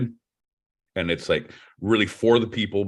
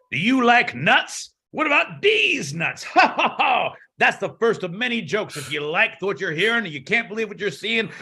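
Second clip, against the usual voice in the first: snake oil seller; 11 semitones higher than usual. Pretend. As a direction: hawk nuts like a sideshow huckster. Carnival barker pitch as Carver.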